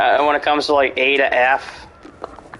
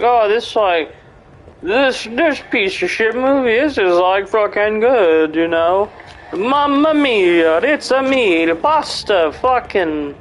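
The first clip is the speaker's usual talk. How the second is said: high, hammed-up Italian accent; exaggerated Italian accent, swooping up high; mock Italian voice; 6 semitones higher than usual. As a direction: mimic him as a sing-song cartoon Italian.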